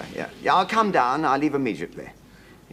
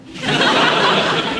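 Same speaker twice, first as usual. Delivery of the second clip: a high, loud burst of laughter; big real laugh; 8 dB louder than usual. Real, not acted. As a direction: laugh at your own line. Laughs at his own boast.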